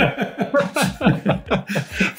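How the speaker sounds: long, high laughing fit; real amused laughter. Real, not acted. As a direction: laugh helplessly at being caught out. Admits he was high.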